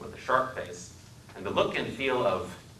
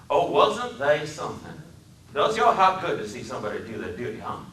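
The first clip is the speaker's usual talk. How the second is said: put-on proud Southern accent; 6 semitones higher, 4 dB louder than usual. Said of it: "His speech was the deepest South".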